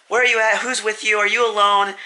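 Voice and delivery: imitated frantic mom, high-pitched; acting out a mother's panic; freaking out. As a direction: press with worried questions, pitched well up. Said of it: "she starts freaking out".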